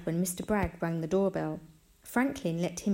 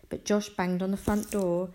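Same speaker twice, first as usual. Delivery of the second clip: usual speaking voice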